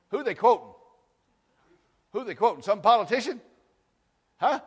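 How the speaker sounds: high, loud mocking questions to the congregation; preaching at full force. Real, not acted. Preaching to the congregation, rising loud challenge.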